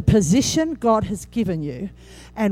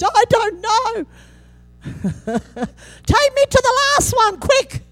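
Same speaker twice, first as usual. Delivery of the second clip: high, slow, hammed-up whine; mock whiny churchgoer; 13 semitones higher, 4 dB louder, much slower than usual. Pastor pretending to be an unhappy churchgoer, high mock whine. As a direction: beg to leave like a sulky child.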